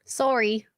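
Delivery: "Sorry" with a rounded "oh", as soar-ee; Canadian pronunciation. Shown as a demonstration. Guest shows host how she says it, Canadian "Sorry". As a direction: answer with a Canadian "Sorry", rhyming with story. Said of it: "Canadian accent".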